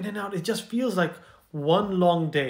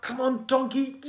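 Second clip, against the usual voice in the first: straining coaxing; 7 semitones higher than usual. Acted. Farmer straining to pull donkey.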